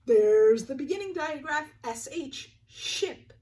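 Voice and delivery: mock wonder for kids; gasping excitement. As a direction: gasp and show the card with delight. Reveals the double digraph card.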